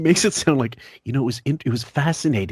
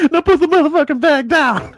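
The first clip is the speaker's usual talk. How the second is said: high, loud mock command; hammed-up fury at full pitch; imitated angry order; 14 semitones higher, 5 dB louder than usual. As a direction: shriek the order as a comic threat. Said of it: "that bit Richard Pryor had".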